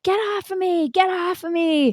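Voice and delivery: hint of a desperate scream; retold scream. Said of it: "I started screaming".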